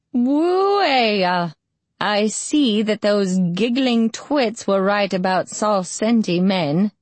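put-on old quavering voice. A narrator's reading. Old healer greets the wounded man, voice quavering. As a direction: croon it like an ancient, quavery crone. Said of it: "just as an old, quavering voice said".

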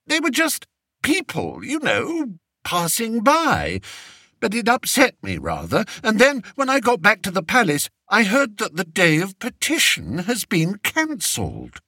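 high, fretful king voice; a spoiled king fishing for comfort; vain fretful king. A narrator's reading. Fred complains to Flapoon.

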